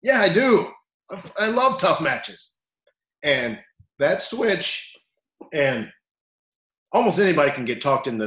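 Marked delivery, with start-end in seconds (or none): 0.0-2.6 surprised realization. Retold or imitated. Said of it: "And you go".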